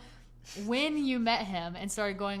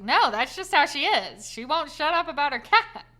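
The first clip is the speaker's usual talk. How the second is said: much louder and higher, an amused protest; exasperated retort; 8 semitones higher, 11 dB louder than usual. Retold or imitated. Telling her dad that Gabby always talks cats, loud amused protest. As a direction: throw it back at him, laughing it off.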